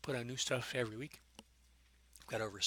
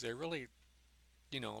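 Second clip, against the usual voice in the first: usual speaking voice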